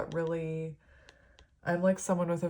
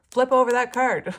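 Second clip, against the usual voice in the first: much higher and louder, urgent command; insistent spirit guide; 7 semitones higher, 10 dB louder than usual. Retold or imitated. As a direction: bark it as an impatient guide. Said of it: "one of them was like".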